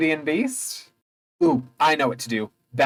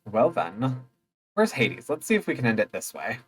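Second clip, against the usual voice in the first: usual speaking voice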